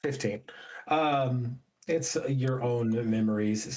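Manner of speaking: normal talking voice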